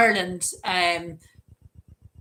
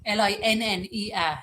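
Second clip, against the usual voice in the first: ordinary delivery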